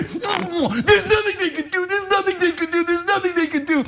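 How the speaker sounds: hammed-up helpless excuse; whiny mock voice. Mocking politicians' excuses.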